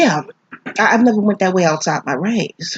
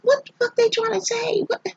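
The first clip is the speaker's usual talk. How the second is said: very high, softer incredulous voice; retold disbelief; 6 dB quieter than usual. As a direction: squeak out mock disbelief. Acts out disbelief at the film.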